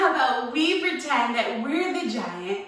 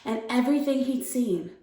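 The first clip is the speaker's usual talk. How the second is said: soft, lower storytelling voice; calm, measured bedtime-story read; story narration; 4 semitones lower, 4 dB quieter than usual. A narrator's reading. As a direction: read a fairy tale gently to young children. Reads a story aloud.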